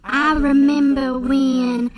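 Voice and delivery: put-on slow, drawn-out Southern storytelling accent; loud, slow country accent. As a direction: reminisce in a hammed-up country drawl. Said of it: "sweet country accent".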